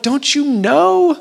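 pitched far up and stretched out slowly; a pained, pleading cry from the dream; anguished plea. Retold or imitated. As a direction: ache it out to his wife, slow and high. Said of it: "Lizzie, in that dream, I'm like".

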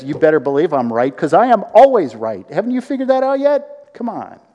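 high, loud, smug "I'm always right"; know-it-all arguer. Retold or imitated. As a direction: play a smug know-it-all winning a fight. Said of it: "Disputing is".

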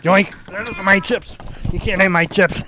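loud, triumphant teasing; gloating. Acted. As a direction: grab it and gloat. Snatching chips back.

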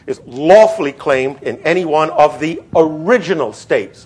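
"lawfully" and "original states" punched out; stress on key words. Shown as a demonstration. Lecturer reading the clause to his class, stressing "original states". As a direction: read the clause firmly, hitting "original states". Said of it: "I want to emphasize the words original states".

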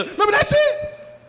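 high, loud, gleeful "Remember that time?"; excited reminiscing. Retold or imitated. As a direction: hype it up like a nostalgic buddy. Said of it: "Their favorite phrase is".

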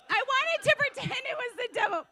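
high, forceful, incredulous preaching; loud exclaiming. Real, not acted. Preacher admitting she blamed the devil, loud exclaiming. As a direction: belt it out as an obvious truth. Preaches over her own excuse.

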